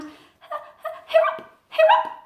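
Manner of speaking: very high chirp attempt; owl trying to chirp. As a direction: copy the grasshopper. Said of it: "She opened her beak".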